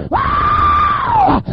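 sudden high, loud ecstatic yelp; ecstatic cry. Real, not acted. Preacher crying out in the spirit, a high loud yelp. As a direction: let out a shout of spiritual joy.

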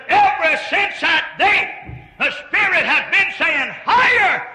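high, loud ringing holler; shouted testimony. Retold or imitated. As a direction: cry it out like she did. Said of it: "when she hollered".

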